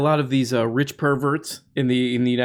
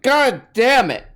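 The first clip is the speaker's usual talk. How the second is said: mock frustration; 10 semitones higher, 6 dB louder, slower than usual. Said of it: "They're like".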